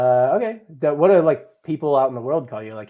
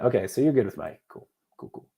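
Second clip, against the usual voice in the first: normal talking voice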